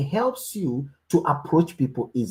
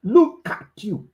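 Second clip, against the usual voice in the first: retold shout; 8 semitones higher, 5 dB louder, slower than usual. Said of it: "The woman said".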